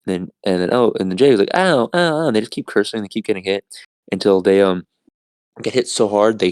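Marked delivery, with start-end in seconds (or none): 1.5-2.3 yelps of pain. Retold or imitated. Jake hit repeatedly.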